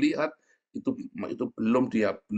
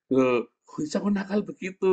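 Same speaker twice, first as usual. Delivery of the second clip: lightly voiced scolding dad, pitched higher; puzzled parental reproach, higher pitch; voiced father; 10 semitones higher than usual. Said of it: "kata papanya". (menirukan papa menegur anak)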